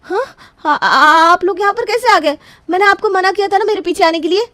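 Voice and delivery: high, crying protest; panicked, near tears. Acted. Exposed bride crying out at her in-laws in panic. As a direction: plead in distress.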